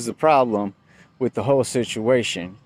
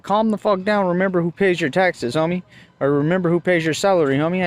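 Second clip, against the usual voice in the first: high, indignant telling-off; retold cussing out; 7 semitones higher than usual. Driver reminding cop who pays him, retold indignation. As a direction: re-enact putting an officer in his place. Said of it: "I ended up cussing this one out".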